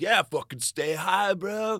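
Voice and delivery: hammed-up party bro yelling; cheering like a stoned dude; stoner bro. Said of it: "people to be like".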